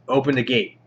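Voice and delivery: ordinary talk, nothing marked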